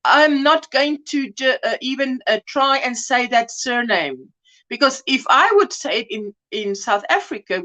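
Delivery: ordinary delivery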